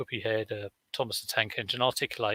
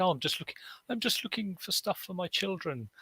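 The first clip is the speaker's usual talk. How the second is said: innocent excuse; 8 semitones higher, 6 dB quieter than usual. Retold or imitated. Retelling own excuse to church women.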